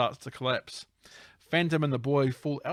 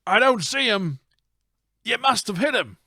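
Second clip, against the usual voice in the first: high, loud acted reply; hammed-up, high-pitched excitement; excited henchman; 8 semitones higher, 6 dB louder than usual. Henchman to gunman after the shot, excited. Acting out villains shooting at Phantom.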